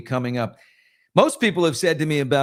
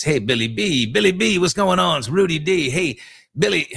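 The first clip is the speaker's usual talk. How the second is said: raised, louder schmoozy Hollywood pal; old Hollywood guy; 5 semitones higher, 4 dB louder than usual. Retold or imitated. Friend Rudy calling Billy, in a louder old Hollywood voice. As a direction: greet him like an old Hollywood operator. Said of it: "talks like this".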